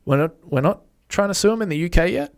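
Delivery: mock rallying the troops; opportunistic schemer. Podcaster voices opportunistic litigants rounding up the boys, mock eager.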